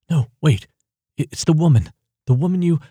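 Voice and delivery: high, loud, urgent pleading; rushing to stop her leaving; urgent plea. A narrator's reading. Ava begging the prisoner not to walk away, urgent and loud.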